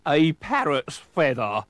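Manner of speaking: pirate's cry. A narrator's reading. Pirate identifies the clue, slow loud cry. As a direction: cry it out slowly in character. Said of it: "cried Fuzz".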